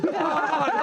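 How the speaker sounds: high loud laughing burst; burst of laughter. Retold or imitated. Brother bursting out laughing at the angry driver. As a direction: crack up suddenly. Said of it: "busting out laughing".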